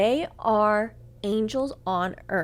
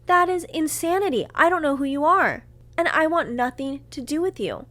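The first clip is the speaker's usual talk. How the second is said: higher, louder, emphatic retelling of anger; heated, punched-out accusation; indignant confrontation; 7 semitones higher, 4 dB louder than usual. Retold or imitated. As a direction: lay down the verdict, voice raised. Retold furious confrontation with the cheater.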